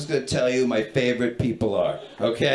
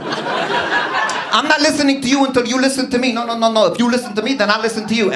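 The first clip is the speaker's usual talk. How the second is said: much higher, heated rapid-fire retort; pitched-up bickering woman; 7 semitones higher, 4 dB louder than usual. Retold or imitated. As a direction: act out a stubborn quarrel, loud and high. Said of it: "They were just arguing".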